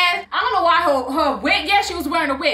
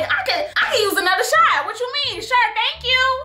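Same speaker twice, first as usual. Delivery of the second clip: sarcastic retort; 8 semitones higher than usual. Retold or imitated. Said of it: "I said, like".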